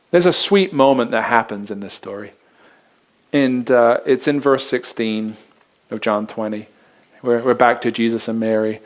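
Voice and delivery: ordinary delivery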